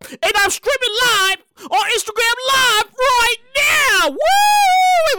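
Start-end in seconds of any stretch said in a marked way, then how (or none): 4.2-5.2 sudden shout. Real, not acted. Stops to clap.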